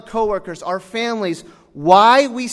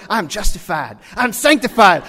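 imitated pulpit fervor; fired-up preacher; 3 semitones higher, 7 dB louder than usual. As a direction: proclaim it like a worked-up preacher. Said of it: "getting my preacher juices flowing".

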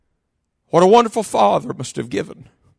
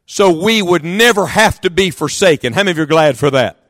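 ordinary talk, nothing marked

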